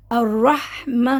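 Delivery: الرحمہ slowly drawn, ending in a breathy ہ; pausal recitation. Shown as a demonstration. Teacher showing the pause on الرحمہ. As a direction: stop on الرحمہ and let the ہ breathe out. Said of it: "ہا نکلے گا تھوڑا سا".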